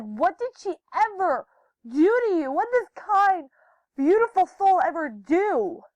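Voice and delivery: high, loud, drawn-out indignation; imitated outraged yelling. Hannah confronting Jacob in her friend's defense, imitated yelling. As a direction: act out a friend's outraged demand. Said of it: "I heard Hannah actually yelling at Jacob".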